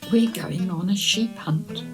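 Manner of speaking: usual speaking voice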